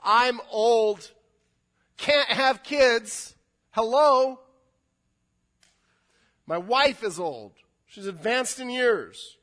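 loud, high, drawn-out exasperation; old priest loudly doubting the angel; loud incredulous protest. Retold or imitated. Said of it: "he says".

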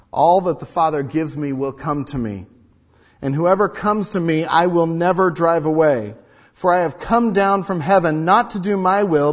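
loud solemn scripture; preached reading. Acted. Pastor reading John to the church, preaching. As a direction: proclaim the verse.

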